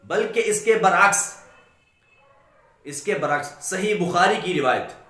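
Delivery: usual speaking voice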